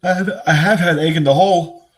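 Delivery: high, loud, laughing confession. Real, not acted. Owning up to chat she's had egg in the hole, laughing. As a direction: laugh through the admission.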